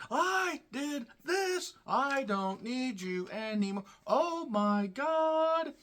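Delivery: high, slow, whiny parody of a wrestler's promo; sneering impression of a heel wrestler, way up high and slow; mocking promo voice. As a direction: drag it out in a high mocking whine. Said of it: "they were talking like this".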